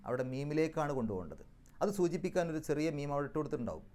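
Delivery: ordinary delivery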